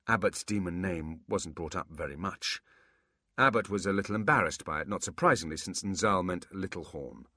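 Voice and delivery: ordinary delivery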